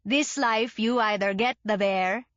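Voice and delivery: lightly voiced French-Canadian drunk father; a hint of a French-Canadian accent. A narrator's reading. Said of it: "in his French-Canadian accent".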